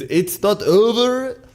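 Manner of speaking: mock Swedish cartoon walrus accent; hammed-up Swedish accent, imitating the walrus. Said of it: "it's the Swedish accent".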